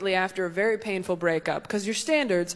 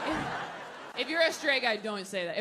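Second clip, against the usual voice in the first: ordinary talk, nothing marked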